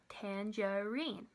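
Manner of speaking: "tangerine" slowly, in three drawn-out beats; sounded out by syllable. Shown as a demonstration. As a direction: stretch "tangerine" as tan, ger, ine. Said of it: "So let's try that".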